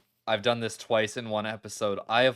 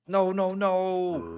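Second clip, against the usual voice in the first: slow, high wailing no; drawn-out wail; 8 semitones higher, 4 dB louder, much slower than usual. Real, not acted. Player watching her own death, slow and wailing. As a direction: wail as you watch yourself die. Dying in the replay.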